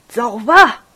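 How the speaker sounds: put-on loud, abrupt old man's command; angry shout. A narrator's reading. Old father ordering his son to leave, angry shout. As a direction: send him off with an angry burst. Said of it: "生气地大声喊叫".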